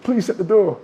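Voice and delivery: high pleading request; bodyguard plea. Retold or imitated. Bodyguard asking [name] to shut the door, high plea. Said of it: "He said".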